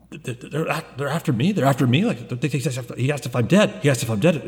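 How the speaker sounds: hushed, stuttering panic; frightened stammer. Acted. Game master as hunted man pleading with crew, frightened stammer. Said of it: "And then you hear, like".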